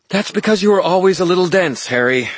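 high, loud, tart retort. A narrator's reading. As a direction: snap it back tartly. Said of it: "her voice tart".

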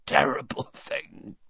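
breathy trailing whisper. Acted. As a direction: let it fade to a whisper. Repeated, trailing off.

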